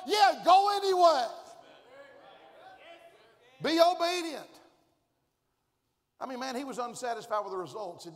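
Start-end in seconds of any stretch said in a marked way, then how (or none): 0.1-4.5 high, loud, fast divine order; shouted command. Acted. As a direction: boom it like God sending him back. God voiced commanding.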